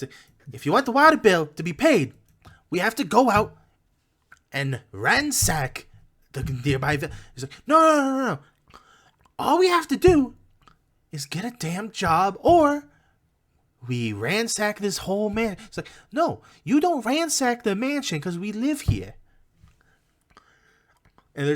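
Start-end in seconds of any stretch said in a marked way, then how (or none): 0.5-7.1 high, loud arguing goblin, easing off; heated goblin. Acted. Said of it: "they're having a heated discussion". 7.7-15.6 high-pitched, loud objecting goblin; exasperated high goblin voice; heated goblin. Acted. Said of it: "they're having a heated discussion". 16.1-19.2 high-voiced goblin objection; squabbling high character voice; heated goblin. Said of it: "they're having a heated discussion".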